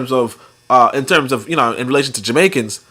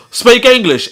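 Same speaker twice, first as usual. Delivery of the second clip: imitated classmate; 8 semitones higher, slower than usual.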